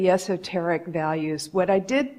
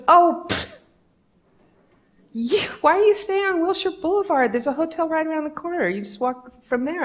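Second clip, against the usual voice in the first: higher, breezy imitation of the receptionist; 9 semitones higher than usual. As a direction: toss it off like it's nothing. Receptionist answers casually.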